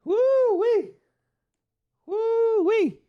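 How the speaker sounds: high falsetto whoop, loud; real fight-night whoop; excited woo. Real, not acted. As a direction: let out a wild hype cry. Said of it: "I'm already on ten".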